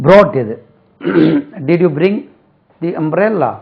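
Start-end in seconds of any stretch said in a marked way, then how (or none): none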